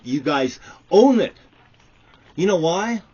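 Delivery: high, loud furious yell; furious shout. Real, not acted. Ranting man yells at the company that owes him. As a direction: shout it at them in real fury. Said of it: "I am so fucking angered".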